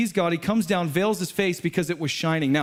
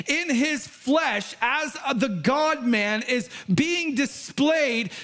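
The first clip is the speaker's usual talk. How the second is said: preaching at full force; 6 semitones higher than usual. Acted. Preacher to congregation, impassioned preaching. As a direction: lift the room as the preacher.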